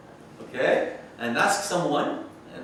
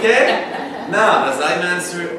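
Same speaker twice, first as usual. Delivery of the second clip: loud laughing; 5 semitones higher, 12 dB louder, slower than usual. Real, not acted. Teacher laughs, loud, amused.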